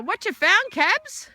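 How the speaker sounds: high, loud playful question to dog; pet talk. Real, not acted.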